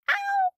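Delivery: very high, brief "ow"; real sudden high-pitched ouch; yelp of pain. Real, not acted. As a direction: squeak out a pained yelp. Hits own hand.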